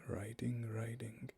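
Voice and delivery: whispered echo, fading away; soft whispered riding, riding. Acted. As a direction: breathe the repeat like hoofbeats fading.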